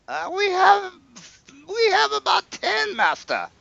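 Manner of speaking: very high timid counting voice; squeaky servant. Acted. Servant Jerome answers his master about the buckets. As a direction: pipe up like a meek henchman.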